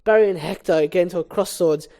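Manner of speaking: ordinary talk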